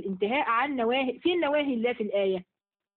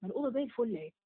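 put-on softer, innocent kid denying it; son's denial; 7 dB quieter, faster than usual. Son tells his mom the room is fine, softer and innocent. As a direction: shrug it off like a child. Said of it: "هيقول لك إيه؟".

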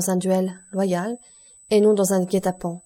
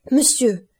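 higher, louder desperate call; anguished cry; 5 semitones higher, 4 dB louder than usual. A narrator's reading. Franz crying out to Noirtier, anguished and loud. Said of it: "s'écria-t-il".

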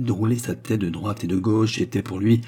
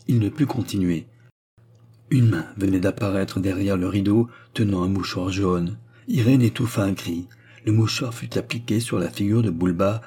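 plain reading voice

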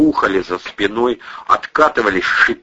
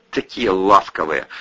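usual speaking voice

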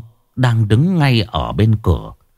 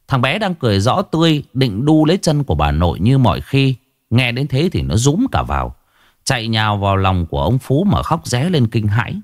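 ordinary delivery

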